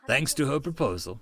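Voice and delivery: plain reading voice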